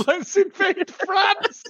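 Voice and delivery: high, loud, drawn-out delight; gleeful high quote. Retold or imitated. Fan gleefully quoting her favourite Keely line, high and slow. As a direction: savour the line slowly and loudly.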